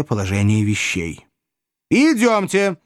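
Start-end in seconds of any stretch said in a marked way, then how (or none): 1.9-2.8 high-pitched call to follow; brisk summons. A narrator's reading. Young man pulling Pickwick away with him, high-pitched summons. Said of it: "проговорил зелёный фрак".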